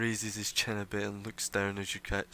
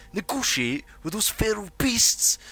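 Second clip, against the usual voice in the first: much higher, louder outraged shout; the heckler scoffing at the leader; angry interruption; 12 semitones higher, 9 dB louder than usual. Acted. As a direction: cut her off in disgust. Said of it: "Same guy".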